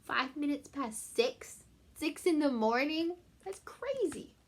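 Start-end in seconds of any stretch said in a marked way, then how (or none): none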